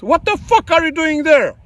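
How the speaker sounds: high, exasperated demand; angry outburst. Real, not acted. To the West over uranium, a high angry demand. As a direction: throw the question out in real outrage. Venting anger at neighbouring country.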